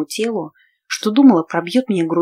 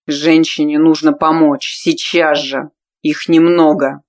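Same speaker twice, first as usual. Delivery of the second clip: calm clipped man's order; 6 dB louder, slower than usual. A narrator's reading. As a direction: rap out the order coolly, holding anger in. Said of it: "спокойно отчеканил".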